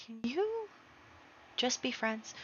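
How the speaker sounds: high, very soft little plea; soft pleading. Pretend. Player to her fighting Sims, pleading softly. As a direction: coax them in a tiny voice.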